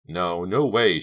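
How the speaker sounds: plain reading voice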